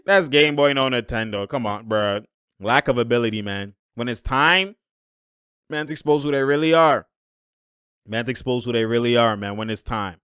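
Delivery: ordinary talk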